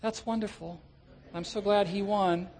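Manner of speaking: mock a half-hearted churchgoer's reply; unenthused churchgoer. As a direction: lukewarm mumble, unimpressed by the victory. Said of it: "and you go".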